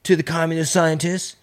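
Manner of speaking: high raspy voice answering a senator; squeaky evasive official testifying; Fauci impression. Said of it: "You do not know what the definition of gain of function is".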